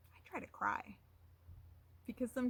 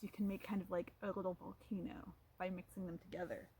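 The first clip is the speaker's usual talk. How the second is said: ordinary delivery, nothing marked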